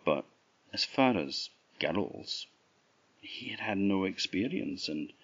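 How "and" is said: ordinary delivery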